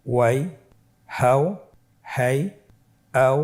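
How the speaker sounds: normal talking voice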